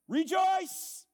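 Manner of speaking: a high, loud cry of "Rejoice!"; joyful shout. Real, not acted. Preacher urging his congregation to rejoice, shouting high and loud. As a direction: burst out with joy. Preacher exhorts struggling Christians.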